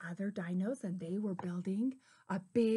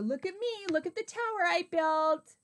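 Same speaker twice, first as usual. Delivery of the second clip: high, boastful call; lightly shouted storybook boast; 7 semitones higher, 3 dB louder than usual. A narrator's reading. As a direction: show off in a raised, high voice. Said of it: "he shouted".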